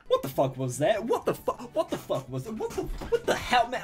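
laughing in real disbelief, pitch jumping; stunned laughter. Real, not acted. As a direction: burst out laughing through the words. Stunned laughing reaction to the video.

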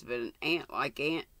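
usual speaking voice

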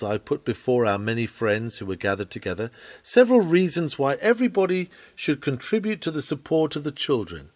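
ordinary talk, nothing marked

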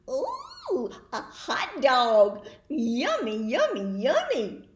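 loud, greedy delight over a hot dog; excited pigeon. Acted. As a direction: drool over the treat, loudly.

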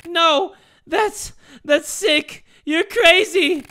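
high, loud acted horror; hammed-up distressed heroine; horrified outcry. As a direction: cry out in disbelief at a madman. Mia horrified by the plan.

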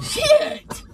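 cursing through a laugh; laughing curse. Real, not acted. Laughing at the joke.

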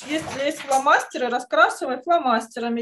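usual speaking voice

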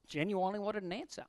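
ordinary delivery

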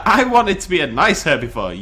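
ordinary talk

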